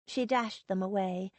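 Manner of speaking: usual speaking voice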